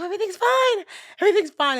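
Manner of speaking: high, loud fake-bright denial; forced cheer. Telling her best friend Linda all is fine, forced cheer. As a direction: pretend everything's okay, too brightly.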